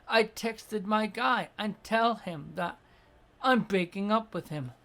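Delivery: ordinary delivery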